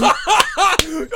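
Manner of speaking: squealing laugh; high burst of laughter. Real, not acted. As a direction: crack up high.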